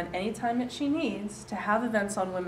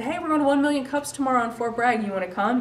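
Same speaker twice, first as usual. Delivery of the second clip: louder, lifted retold invite; acting out a lively invitation; upbeat invitation; 6 semitones higher, 6 dB louder, faster than usual. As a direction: pitch it up like an eager invite. Retelling her invitation to coordinator.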